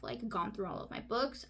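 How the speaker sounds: ordinary talk, nothing marked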